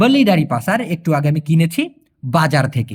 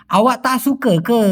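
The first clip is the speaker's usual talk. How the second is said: slow Malay question with সুকে rising, in a Malaysian accent; 3 semitones higher than usual. Shown as a demonstration. Teacher showing how Malaysians ask it, slow Malaysian accent. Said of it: "খোদ মালয়েশিয়ানদের মতো বলতে গেলে".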